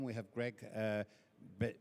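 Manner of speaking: ordinary talk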